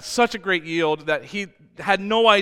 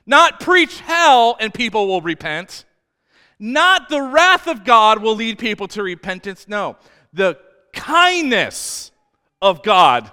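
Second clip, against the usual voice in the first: loud, high, fervent preaching; impassioned pulpit preaching; full-force preaching; 5 semitones higher, 9 dB louder than usual. Acted. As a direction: preach it out to the back row. Preaching loud about God's kindness.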